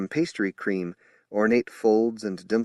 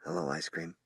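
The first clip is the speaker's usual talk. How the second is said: much softer, gentle hello; 3 semitones lower, 10 dB quieter than usual. A narrator's reading. Shy old man greeting the girl, very soft. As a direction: breathe it out gently. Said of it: "in a very soft voice".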